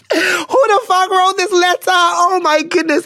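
high, squealing, tearful disbelief; helpless with laughter. Real, not acted. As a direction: squeal it out, nearly crying with laughter. Overcome with laughter at letter.

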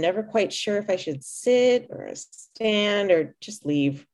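ordinary delivery, nothing marked

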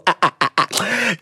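quiet, pulsing tail of laughter; soft chuckle. Real, not acted. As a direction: let the laughter die down. Laugh tails off.

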